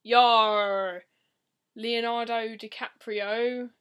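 loud pirate "Yar", then slow name; mock pirate call. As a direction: growl it like a hammy pirate. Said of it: "he's like".

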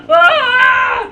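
sharp high startled yell; startled shout. Real, not acted. Gamer yelling when the toy plane hits. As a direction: yell as it catches you off guard.

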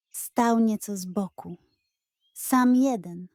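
ordinary delivery

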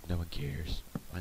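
whispered aside; soft whispered aside. Real, not acted. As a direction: toss it away under the breath. Muttered aside.

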